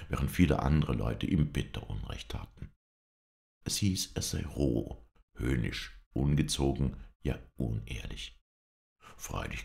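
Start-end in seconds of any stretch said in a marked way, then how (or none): none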